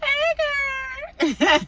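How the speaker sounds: loud high-pitched mocking greeting; exaggerated high Hey girl. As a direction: stretch the Hey like a fake bestie. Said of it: "It's like that Friday mother".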